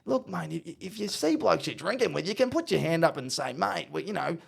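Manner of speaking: comic high-voiced coaching talk; Ross Lyon. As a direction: advise the player in a high coach voice. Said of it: "He goes".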